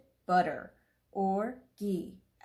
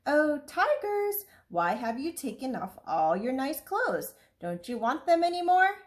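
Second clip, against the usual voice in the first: calling out; 5 semitones higher than usual. Acted. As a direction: call across to the tigers. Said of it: "called out".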